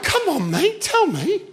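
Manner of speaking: high, coaxing wheedle to a grown man. Retold or imitated. Teasing a shy audience member, high coaxing. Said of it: "And I went".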